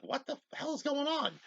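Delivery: very high, soft mimic voice; imitating a bewildered officer in falsetto; bewildered woman. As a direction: put on a squeaky, baffled woman's voice. Retold Uhura, bewildered at the call.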